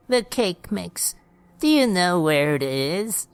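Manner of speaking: put-on high, drawn-out old woman's voice; old lady. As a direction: ask very slowly as an old lady. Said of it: "as the old lady spoke".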